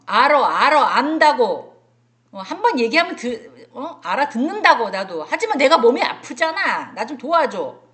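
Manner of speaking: shouting, irritated and demanding; angry loud complaint. Retold or imitated. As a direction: snap back at the teacher loudly. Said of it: "톤이 어땠어요? 앞에 거랑 뒤에 거랑?". (짜증 섞인 큰 소리로 항의하듯)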